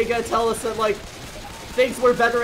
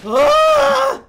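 long, high, loud scream; panicked scream; 10 dB louder than usual. Real, not acted. Player swarmed by zombies, long panicked scream. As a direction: scream at full voice.

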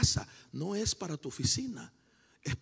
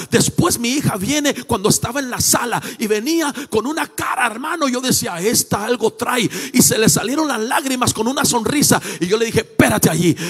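testimony building from raised voice to loud cry; impassioned preaching; 8 semitones higher, 15 dB louder than usual. Real, not acted. Preacher telling church his vision of a child, impassioned building. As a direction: preach it higher and louder as it builds.

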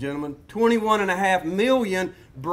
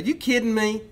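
higher, disbelieving exclamation; exasperated rant; 5 semitones higher than usual. Real, not acted. Ranting lecturer to the class, exasperated disbelief. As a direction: throw it out in disbelief. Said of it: "the rant's over".

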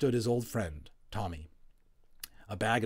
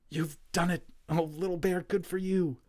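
high, delighted praise; a boy's thrilled whisper-cheer; excited cheer; 9 semitones higher than usual. Acted. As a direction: cheer the little hero on. Boys cheer Little Bear's return.

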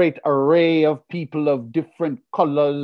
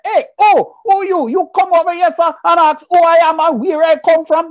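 much higher, louder, fiery Jamaican rant; vexed Jamaican patois; 13 semitones higher, 4 dB louder than usual. Retold or imitated. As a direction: let the Jamaican temper erupt like a volcano. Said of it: "I look at the man and I say".

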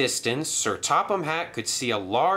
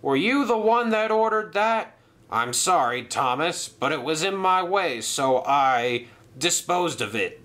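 Cranky the crane; 7 semitones higher than usual. Acted. Cranky voiced.

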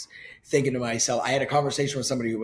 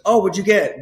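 mock eager friend; 7 semitones higher, 9 dB louder than usual. Friend's eager question retold.

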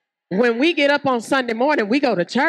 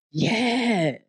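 ordinary delivery

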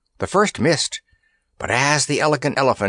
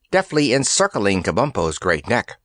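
plain reading voice

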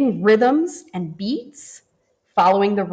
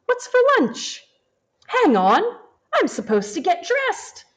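lightly voiced eager child, much higher; hungry kid voice; 10 semitones higher than usual. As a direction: ask it like a kid thinking of lunch. Child character voiced in book.

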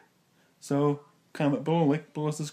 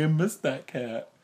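ordinary delivery

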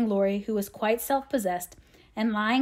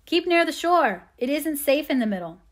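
higher-pitched shout of caution; shouting back; 5 semitones higher, 4 dB louder than usual. A narrator's reading. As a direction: call a warning back over distance. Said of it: "he shouted back".

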